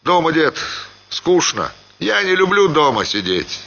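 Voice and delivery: барин, заметно выше, легко; беспечно отмахивается, с улыбкой в голосе; голос Ханова. A narrator's reading. Ханов отвечает деду. (Ханов беспечно отвечает деду Семёну, выше)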